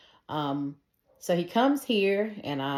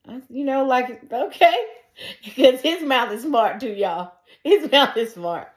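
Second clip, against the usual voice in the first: higher and louder, laughing through the words; 8 semitones higher, 7 dB louder than usual. Real, not acted. Telling the audience about dad, laughing loudly. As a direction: crack up at how smart his mouth is.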